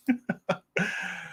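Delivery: corny dad laugh. Real, not acted. Still laughing at own joke.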